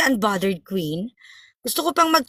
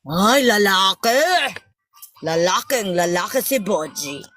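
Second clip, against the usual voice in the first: lowered, teasing he-man voice; mock manly voice; 5 semitones lower than usual. Host teasing Boji as all man, mock manly voice. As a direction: drop the voice low, imitating a man. Mimics Boji's deep manly answer.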